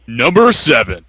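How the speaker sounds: slow fighting game announcer call. Acted. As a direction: stretch it out like a ring announcer. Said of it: "[name] putting on his new announcer voice".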